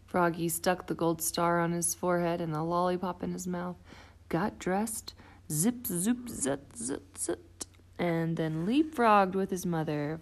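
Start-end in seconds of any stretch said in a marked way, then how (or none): 5.5-7.0 playful zip noises. Acted. Dressing sound effects.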